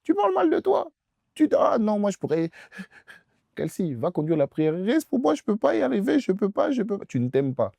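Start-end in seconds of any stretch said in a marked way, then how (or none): 1.6-7.1 high, pleading whine; whiny despair. Retold or imitated. Preacher imitates a despairing believer begging someone to pray. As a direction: plead helplessly, voice pitched way up.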